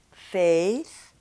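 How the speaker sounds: "Face" gliding from e to i; English side of the drill; English diphthong. Shown as a demonstration. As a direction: loose jaw on "Face". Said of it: "In English".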